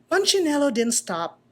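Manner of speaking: usual speaking voice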